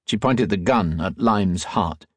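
ordinary delivery

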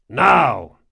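loud call for attention; shout. A narrator's reading. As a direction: shout over the deck. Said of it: "he shouted".

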